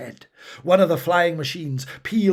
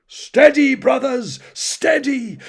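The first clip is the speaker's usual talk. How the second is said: high, loud battle cry holding the line; commanding shout; 11 semitones higher, 7 dB louder, slower than usual. A narrator's reading. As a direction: bark it out to hold eager warriors back. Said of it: "shouted Thorgrim".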